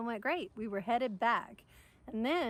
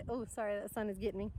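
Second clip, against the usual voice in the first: ordinary delivery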